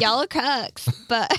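loud Southern belle drawl; Southern accent. Acted. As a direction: sass them like a Southern belle. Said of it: "now she's Southern".

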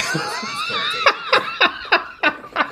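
squeaky drawn-out laughing cry; high laughing squeal. Real, not acted. Laughing at character reviving.

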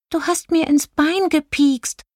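high-pitched, mildly cross fairy voice; scolding little fairy. A narrator's reading. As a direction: scold in a tiny high voice, without shouting. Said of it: "schimpfte sie".

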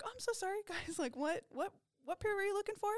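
high, flustered apology with a laugh; sheepish apology. Retold or imitated. Stoned clerk apologizing to customers, high and flustered. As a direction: pitch way up, apologize like a frazzled clerk.

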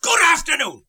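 exasperated dismissal. Acted. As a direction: throw him out with it. Scrooge dismisses nephew third time.

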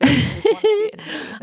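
high, fast fangirl squeal; excited gush. Real, not acted. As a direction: gush it out like a thrilled fan. Gushing about favourite book scene.

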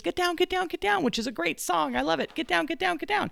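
high singsong mock yell of get down; mom yelling get down. Dog voicing its owner's yelling, high singsong mock yell. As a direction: imitate mom yelling, half singing it. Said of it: "my mom yells at me and says".

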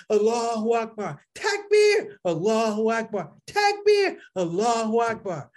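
mock crowd chant; crowd call and response. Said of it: "five hundred people like".

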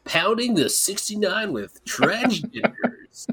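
high, slow, drawn-out mock DJ; radio voice. Podcaster continuing the joke, mock radio voice. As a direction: stretch it out like a hammy radio announcer. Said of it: "That's my radio voice".

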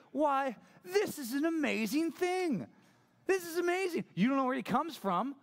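hammed-up incredulous sass; peak sassiness. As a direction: squeal it in exaggerated sarcastic wonder. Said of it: "This is the peak sassiness".

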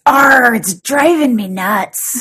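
slow, laughing pirate growl. Acted. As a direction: draw out the Arr like a pirate. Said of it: "And the pirate says".

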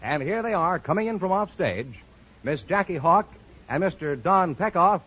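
ordinary talk

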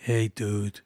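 a weak try at a Californian surfer greeting; half-hearted Californian surfer accent. Shown as a demonstration. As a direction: attempt a laid-back Californian "Hey, dude", not quite landing. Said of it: "Oh, that wasn't very good".

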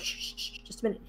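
whispered shush, then a soft aside; imitating a group hushing someone; hushed shushing. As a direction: shush softly, keeping it very quiet. Everybody shushing a talker in cutscene.

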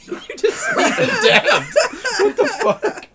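high, slow words broken by laughter; squealing laugh through the words; laughing in disbelief. Real, not acted. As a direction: laugh it out, can't believe what he saw. Friend acts bizarrely, laughing disbelief.

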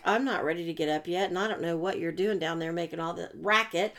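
lightly voiced grumpy cat. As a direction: voice the annoyed cat, fast at first. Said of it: "he's laying up in the bed like".

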